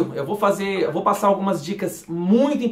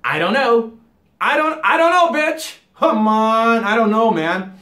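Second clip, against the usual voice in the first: slurred casual American; 3 semitones higher, 5 dB louder, slower than usual. Shown as a demonstration. Teacher showing how people really say it. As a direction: toss it off like a bro. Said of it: "As pessoas falam".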